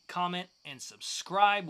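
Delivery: normal talking voice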